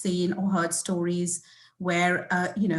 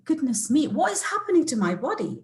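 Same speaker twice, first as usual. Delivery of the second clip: higher, louder-edged retold disbelief; shocked; 7 semitones higher, 3 dB louder than usual. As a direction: act out a startled inner reaction.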